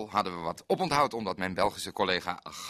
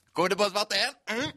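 gruff doorman; 11 semitones higher, 6 dB louder than usual. Retold or imitated. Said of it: "zei de man".

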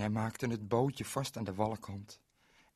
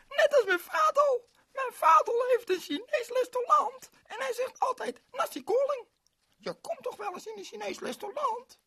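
put-on much higher, louder little girl voice; 8 dB louder than usual. Ling introduces herself to Berend, high girlish voice. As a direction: play a bright small girl. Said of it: "juichte het meisje Ling".

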